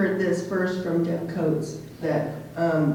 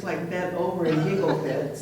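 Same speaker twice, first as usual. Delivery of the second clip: ordinary talk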